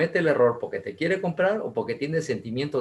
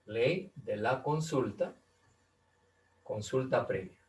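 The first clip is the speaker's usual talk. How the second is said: slow dictation; 7 dB quieter, slower than usual. Shown as a demonstration. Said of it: "slow dictated repeat".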